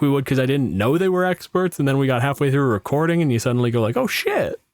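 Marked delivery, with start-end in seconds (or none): 4.0-4.6 surprised. Retold or imitated. Retold sudden realization mid-recording.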